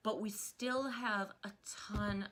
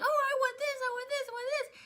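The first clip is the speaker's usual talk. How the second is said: put-on much higher, squealing little kid; eager kindergartner; 5 dB louder than usual. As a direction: play a greedy kindergartner begging. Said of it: "they're like".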